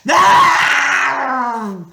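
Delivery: loud, raging monster roar; roar of rage. A narrator's reading. Medusa struck by Grover, roaring. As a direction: bellow in pain and fury. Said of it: "Medusa roared with rage".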